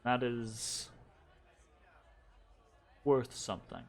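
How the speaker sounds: low, measured noblewoman character; a hint of a formal noble; lightly voiced noble. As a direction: speak low and deliberate, granting a favor. Game master voices noblewoman character.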